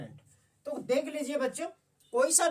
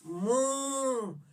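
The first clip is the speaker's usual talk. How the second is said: long hummed mmm; much slower than usual. Shown as a demonstration. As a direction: stretch the hum out very slowly. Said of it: "बाद में तो आपने जो है ये कर दिया".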